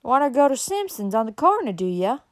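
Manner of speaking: nosy country woman's holler; backwoods woman. A narrator's reading. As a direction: call out like a hill-country woman. Backwoods woman questions the stranger.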